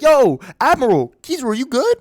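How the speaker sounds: loud, high acted shout of concern; calling out. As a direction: call to someone lying far off. Calling out to fallen admiral.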